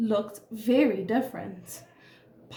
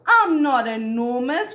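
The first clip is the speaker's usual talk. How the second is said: louder, mildly indignant storybook grumble; grumbling Elephant; 7 dB louder, slower than usual. A narrator's reading. Elephant answers teasing friends, mildly grumbling. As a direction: protest with a touch of grump. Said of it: "grumbled Elephant".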